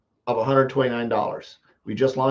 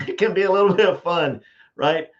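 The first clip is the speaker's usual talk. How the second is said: laughing; 6 semitones higher, 4 dB louder than usual. Real, not acted. Laughs about competitive personality.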